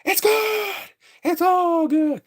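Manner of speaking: high, slow sing-song it's all good; squeaky mock calm; mock high reassurance. Kidding the audience after a gory kick, squeaky mock calm. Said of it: "I'm like".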